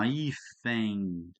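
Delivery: hífen drawn out very slowly; slow demonstration. Shown as a demonstration. Teacher to class, showing how hífen is read: very slow. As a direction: stretch out hífen so each sound is heard. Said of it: "A gente não consegue ler assim".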